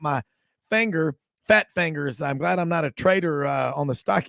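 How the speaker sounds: ordinary delivery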